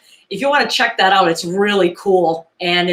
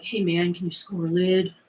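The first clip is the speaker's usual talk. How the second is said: imitated drug buyer; 7 dB quieter than usual. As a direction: murmur it like a furtive buyer. Quoting a drug buyer's slang.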